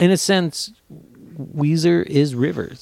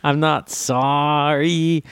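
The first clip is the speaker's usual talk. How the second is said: slow, long-stretched "sorry" in a DeLong voice; exaggerated DeLong drawl on "sorry"; DeLong-style sorry; much slower than usual. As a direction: drag out "sorry" theatrically. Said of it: "There's a little DeLong in his voice when he says sorry".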